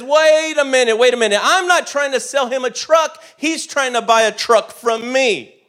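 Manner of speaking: high, loud indignant salesman; defensive attitude. Retold or imitated. Salesman snaps back at dad, high indignant attitude. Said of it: "Complete attitude".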